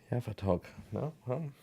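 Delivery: testing a voice that slipped away, softly; quiet, unsteady check of your own voice; voice giving out. Real, not acted. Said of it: "like, it totally went away".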